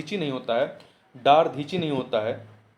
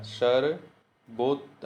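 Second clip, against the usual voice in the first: सर्वोत- drawn out very slowly; slow down on सर्वोत- to show the spelling; slow spelling demo; 3 semitones lower, much slower than usual. Shown as a demonstration. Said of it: "आधा ता फिर पूरा ता". (सर्वोत- बहुत धीरे)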